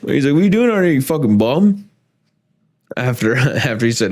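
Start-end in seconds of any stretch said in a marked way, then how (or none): 0.3-1.9 lightly voiced brother ribbing him, higher; mock teasing. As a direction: tease like a big brother, pitched up.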